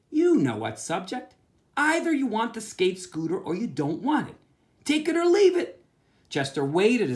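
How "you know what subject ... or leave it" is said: put-on a kid's voice pressing for a deal, climbing higher and louder to the end; lightly voiced boy laying down a final offer; Chester's voice. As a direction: push the ultimatum, ending high and loud.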